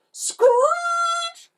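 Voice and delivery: very high, drawn-out squeal; screeching tyres. A narrator's reading. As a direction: screech the word like skidding tyres. Narrator imitates van screeching.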